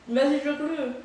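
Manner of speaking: laughing and loud, egging on; excited. Real, not acted. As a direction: cheer them on through a laugh.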